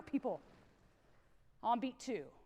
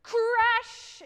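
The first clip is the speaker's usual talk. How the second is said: mock crash; 9 semitones higher, 9 dB louder than usual. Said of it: "We heard".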